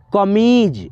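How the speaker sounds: कमीज with a drawn-out मी; कमीज said as ka-meeej; stretched long ee. Shown as a demonstration. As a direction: lengthen the ee in कमीज. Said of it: "खींच करके आवाज़ आया".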